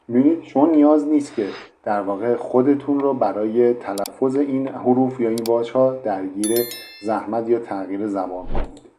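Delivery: normal talking voice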